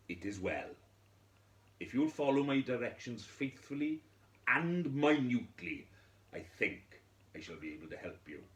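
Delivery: lightly voiced wise man, firm and assured; a touch of the calm old sage promising help. Wise man answering the widow, lightly voiced and assured.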